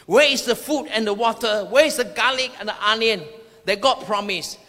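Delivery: ordinary talk, nothing marked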